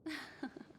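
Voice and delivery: a short, quiet, high laugh; soft relieved laugh. Real, not acted. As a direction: laugh softly, relieved it's over. Relieved after the fight ends.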